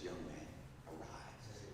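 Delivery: soft, low, gentle command; hushed voice of Jesus raising the dead. Acted. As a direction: speak it quietly, like a tender order. Jesus raising the widow's son.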